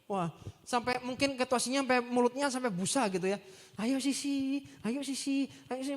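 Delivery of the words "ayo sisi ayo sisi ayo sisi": mock eager follower pleading again and again; lightly voiced eager disciple begging; eager pleading call. Pastor imitates a churchgoer eagerly chasing the leader.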